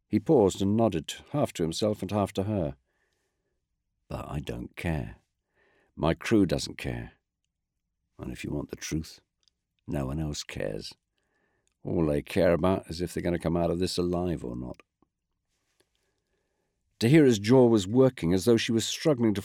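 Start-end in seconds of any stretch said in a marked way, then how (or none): 4.1-5.2 low, soft, slow "I don't care"; quiet refusal. A narrator's reading. Brel quietly repeating he doesn't care, low slow voice. 6.0-14.8 lower, softer, understanding officer; a lightly voiced weary commander explaining calmly; tired commander. A narrator's reading. Brel explaining her anger to her, lower soft voice. Brel's held character voice.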